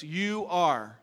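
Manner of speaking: drawn-out, emphatic "You"; stress on You. Shown as a demonstration. Preacher points out next verse's opening, stress on "You". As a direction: land hard on "You", then "are". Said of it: "uses the emphatic you".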